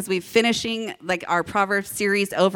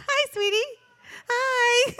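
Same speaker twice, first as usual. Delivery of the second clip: sweet greeting; slower than usual. Real, not acted. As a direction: coo a little hello to your son. Greets her waving son mid-sermon.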